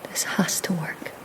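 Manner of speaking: hushed whisper, rising in force; urgent whisper. Acted. Breda bracing to break free, urgent whisper. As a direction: whisper it like a vow.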